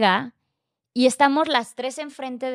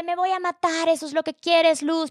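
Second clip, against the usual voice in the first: imitated yelling; 6 semitones higher than usual. Boyfriend yells threats at his partner, imitated outburst. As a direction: mimic his desperate yelling without full volume. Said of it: "empieza a gritar de".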